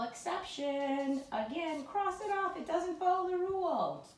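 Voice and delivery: climbing into a high singsong; playful singsong. Shown as a demonstration. Teacher reciting the exception rule, playful singsong.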